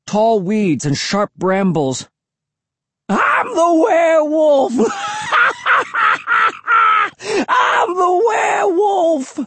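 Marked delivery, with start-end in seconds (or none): 3.1-4.7 very high, crazed shout; high-pitched wailing. A narrator's reading. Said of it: "high-pitched wails". 4.8-7.4 long, high-pitched crazed cackle; wild hermit cackling at full tilt; wailing laughter. A narrator's reading. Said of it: "high-pitched wails of laughter". 7.5-9.4 very high, slow crazed shriek; long-drawn manic hermit cry; high-pitched wailing. A narrator's reading. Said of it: "high-pitched wails".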